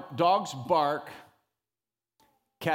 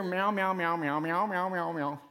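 cat meows; 3 semitones lower than usual. Shown as a demonstration. Preacher imitates a cat to the congregation, mock meowing. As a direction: meow like a cat, half joking. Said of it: "If I just stood up here".

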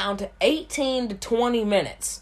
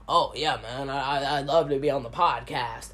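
lower, softer dopey-guy voice; lightly mocking low impression of a fan; mocking a random guy; 7 semitones lower, 3 dB quieter than usual. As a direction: mimic an eager nobody begging for a spot, pitched low. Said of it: "random guy that's like".

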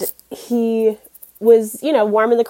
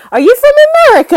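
put-on drunk fan; 12 dB louder, much faster than usual. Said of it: "He says".

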